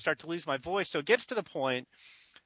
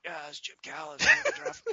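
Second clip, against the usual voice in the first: barely audible croak of a lost voice; rasped-out imitation. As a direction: imitate laryngitis, forcing the words out. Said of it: "just to rasp out".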